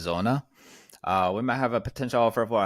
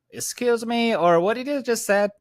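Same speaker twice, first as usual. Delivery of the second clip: high, puzzled seller asking to repeat; confused seller; 14 semitones higher, 6 dB louder than usual. Retold or imitated. Ray mimics a confused seller on the phone, high and puzzled. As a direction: ask like a seller who couldn't follow you. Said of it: "the most response I get from the seller".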